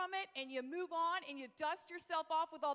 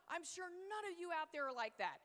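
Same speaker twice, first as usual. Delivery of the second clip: usual speaking voice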